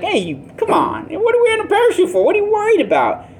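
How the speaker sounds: high-pitched jeering heckler; taunting in a squeaky-high put-on voice; mocking. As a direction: put on a high voice and make fun of him. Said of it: "people are gonna mock this guy".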